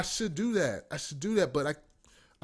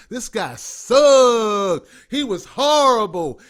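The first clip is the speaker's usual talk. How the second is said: emphatic loud rant; 4 semitones higher, 10 dB louder, much slower than usual. Real, not acted. Ranting about a failed campaign.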